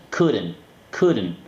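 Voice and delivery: "couldn't" as "cooden", silent L and T; reduced pronunciation. Shown as a demonstration. Teacher modelling the negative, smooth reduced "couldn't". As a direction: schwa between D and N of "couldn't", falling. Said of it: "entre la D y la N meto un sonido vocal".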